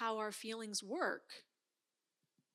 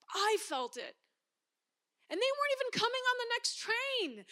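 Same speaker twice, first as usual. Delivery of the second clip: ordinary delivery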